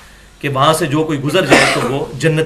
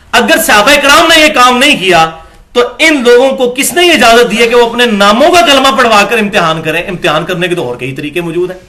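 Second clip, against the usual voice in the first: impassioned preaching; 5 semitones higher, 5 dB louder than usual. Real, not acted. Preacher protesting the false kalima.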